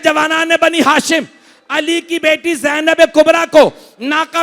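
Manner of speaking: high, loud, drawn-out majlis recitation; preaching at full force. Acted. Preacher to mourners, high chanted preaching. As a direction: lift the mourners with a wailing tune. Majlis peak, shouting lament.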